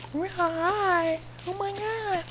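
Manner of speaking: squeaky falsetto far above his own; very high voice. Shown as a demonstration. Gamer showing off his range, very high falsetto. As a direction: jump way up into falsetto. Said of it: "I can go real high".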